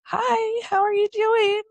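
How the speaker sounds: imitated doctor, high sing-song hello; chirpy, high-pitched retold greeting; doctor's greeting. Doctor greeting a crying new mother, imitated high and sing-song.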